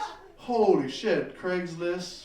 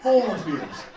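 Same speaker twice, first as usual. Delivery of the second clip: mock Matrix gasp; 8 dB louder, much slower than usual.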